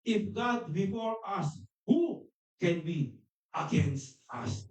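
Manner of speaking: very slow, drawn-out words; preacher repeating the verse in English, very slow; slow emphatic verse. Acted. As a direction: stretch each word of the verse.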